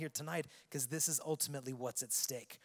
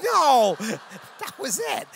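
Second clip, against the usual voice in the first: high, very loud comic outburst; indignant retort; 9 semitones higher, 16 dB louder, much slower than usual. Retold or imitated. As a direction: blurt it out in mock outrage. Retelling indignant answer to guy.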